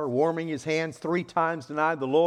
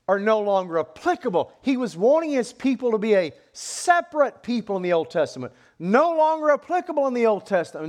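loud, raised pulpit proclamation; forceful preaching; 6 semitones higher, 7 dB louder than usual. Real, not acted. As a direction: drive the point home at full volume.

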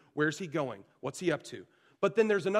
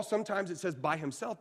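normal talking voice

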